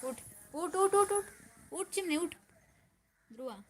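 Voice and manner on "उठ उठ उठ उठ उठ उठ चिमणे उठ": rising get-up chant; urging. Real, not acted. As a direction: coax him up, getting more insistent.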